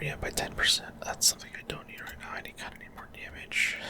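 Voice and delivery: ordinary talk, nothing marked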